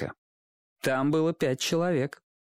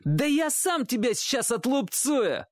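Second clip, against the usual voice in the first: put-on high boyish threatening whine; slow, high-pitched kid's bluster; irritated threat; 11 semitones higher than usual. A narrator's reading. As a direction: threaten him like a cocky little bully. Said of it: "раздражённо воскликнул мальчишка".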